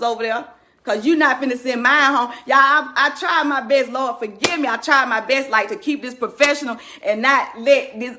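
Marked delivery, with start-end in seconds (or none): none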